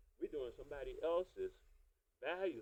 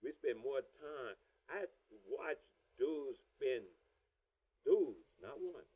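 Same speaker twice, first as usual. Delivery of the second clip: ordinary talk, nothing marked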